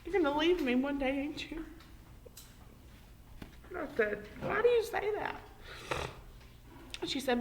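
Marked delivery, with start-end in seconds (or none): 0.0-1.7 higher, earnest retold question; grave question, softening at the end; retold serious question. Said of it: "she got so serious". 4.4-5.3 much higher-pitched retold question; retold protesting question. Retold reply to worrying question.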